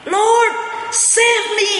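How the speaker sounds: very high, loud frightened plea; a drowning man crying out in terror; sinking Peter crying out to the Lord in panic; panicked cry for help. Retold or imitated. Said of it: "Petrus mulai begini".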